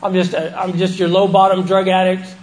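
ordinary talk

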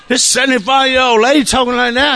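high-pitched amazed inner voice; retold astonishment. His startled thought, retold.

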